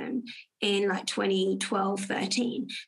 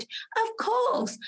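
very high, eager agreement; eager of course; 12 semitones higher than usual. Retold or imitated. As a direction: jump at the chance. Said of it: "I said".

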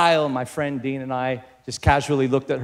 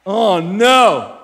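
louder, higher mock-horrified cry; big drawn-out outburst of dismay; 5 semitones higher, 7 dB louder than usual. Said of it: "that loud".

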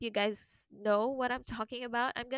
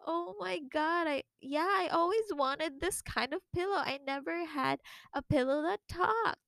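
high, put-on gushing; fake delight; 6 semitones higher than usual. Pretending to love the talking pillow, high and gushing. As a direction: gush to stay polite. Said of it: "I was like".

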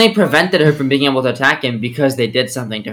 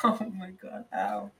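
leg cramp pain; 4 semitones higher, 17 dB quieter, slower than usual. Real, not acted. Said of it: "I got a filthy hamstring cramp".

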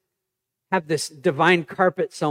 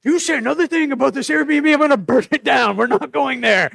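higher and louder, exasperated blow-up; hammed-up dad losing it; mock threat; 8 semitones higher, 6 dB louder than usual. Dad snapping at his son about the Airbnb, mock angry. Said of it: "and I said".

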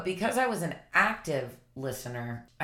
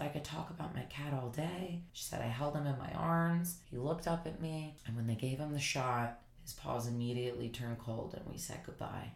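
quiet, heavy retelling of the cat's last moments; hushed grieving; 3 semitones lower, 8 dB quieter than usual. Retold or imitated. Retelling the woman's grief to the viewers, hushed and heavy. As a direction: let it drop soft and low.

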